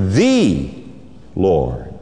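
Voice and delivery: "The" drawn out and set apart from "Lord"; weighty, separated "The ... Lord"; stress on each word. Shown as a demonstration. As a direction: lean on "The", pause, then drop low on "Lord". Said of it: "just emphasize each word".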